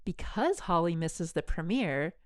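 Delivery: normal talking voice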